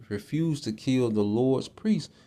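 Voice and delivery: ordinary talk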